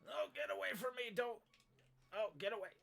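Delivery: high-pitched yelps; panicked. Real, not acted. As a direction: fend off an attacker in a game. Fleeing an enemy in the game.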